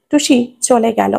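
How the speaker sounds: ordinary delivery, nothing marked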